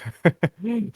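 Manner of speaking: sheepish burst of laughter; laughing. Real, not acted. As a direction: laugh it off, a bit embarrassed. Apologising for his accent.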